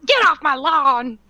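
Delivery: put-on slow grumpy old man yell; shouting at trespasser. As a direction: holler like a cranky old man at kids. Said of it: "those whippersnappers".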